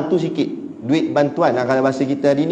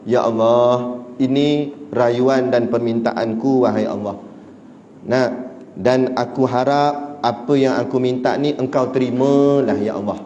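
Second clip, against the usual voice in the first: prayer demo; 4 semitones lower than usual. Shown as a demonstration. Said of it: "ni cara Nabi berdoa".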